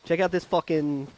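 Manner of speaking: street-corner Delco take on Shakespeare; Delco accent. Acted. Calling Mercutio over about the thumb-biting, Delco accent. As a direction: play it as a Delco guy calling out a rival. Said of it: "say in a Delco accent".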